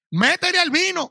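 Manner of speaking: loud, high mock invitation to drink; mock permission. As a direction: ham up a careless preacher egging them on. Mocking what he won't tell believers.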